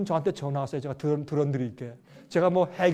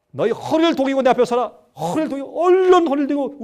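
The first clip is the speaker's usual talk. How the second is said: loud commanding God voice; thundering divine command building up; 8 semitones higher, 11 dB louder than usual. Acted. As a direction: order him to gird up, louder each time. God commanding Job to stand.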